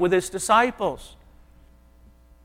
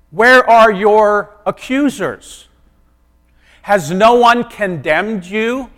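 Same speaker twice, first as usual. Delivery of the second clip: slow, carefully spaced questions, a touch louder; slow gentle question; 4 semitones higher, 7 dB louder, slower than usual. Pretend. Jesus asking the woman, slow and deliberate.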